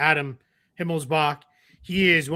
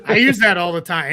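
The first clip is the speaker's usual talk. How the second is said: ordinary delivery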